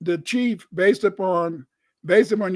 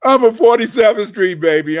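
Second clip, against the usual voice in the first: street pride; 9 dB louder than usual. Real, not acted. Proud boast of his street roots.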